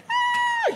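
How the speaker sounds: a very high, loud imitated scream; mock shriek. The girl reacting to the hair, shrieking. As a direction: squeal like the grossed-out girl.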